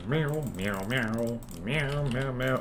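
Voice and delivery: soft human meows; meowing. Pretend. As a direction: meow quietly like a bored cat. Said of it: "I just like to meow".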